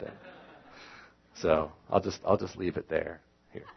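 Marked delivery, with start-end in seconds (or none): none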